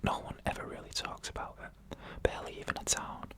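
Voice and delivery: usual speaking voice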